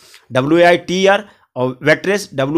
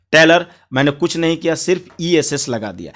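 ordinary talk, nothing marked

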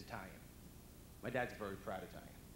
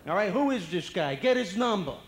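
loud, raised tough-guy brush-off; Italian president; 5 semitones higher, 11 dB louder than usual. Acted. Italian president dodging the press, loud and menacing. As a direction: shut the questioner down with swagger. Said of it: "If we had an Italian for president".

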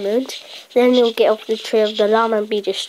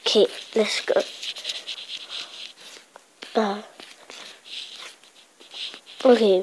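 usual speaking voice

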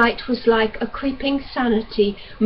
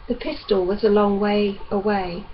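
ordinary delivery

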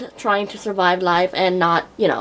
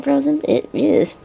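ordinary delivery

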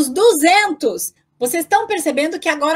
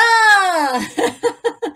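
laugh; 3 semitones higher than usual. Real, not acted. Teacher laughs mid-lesson.

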